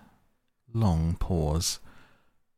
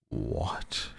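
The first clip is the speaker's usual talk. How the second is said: low, hushed outrage; be aghast under your breath; scandalized; 6 semitones lower, 6 dB quieter than usual. A narrator's reading. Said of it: "greatly scandalized".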